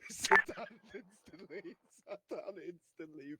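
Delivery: laughing through the words; giggling while retelling. Real, not acted. Laughing while telling story.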